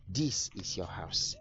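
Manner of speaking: slow, low and gentle, handing over a gift; tender reveal. Acted. Contractor gently tells builder the house is his, slow and tender.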